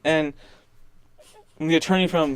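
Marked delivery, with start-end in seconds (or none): none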